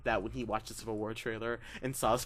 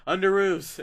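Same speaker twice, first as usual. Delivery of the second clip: higher, louder Underoos call; Tony Stark quote; 7 semitones higher, 9 dB louder, slower than usual. Retold or imitated. Quoting Tony Stark line.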